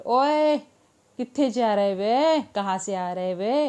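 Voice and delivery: Punjabi-accented Hindi. Shown as a demonstration. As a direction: do it like a Punjabi calling out. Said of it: "तो पंजाब में कैसे बोले?".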